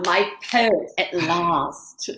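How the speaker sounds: low, slow, adoring greeting; drawn-out doting welcome; swooning. Acted. Greets the poet dreamily.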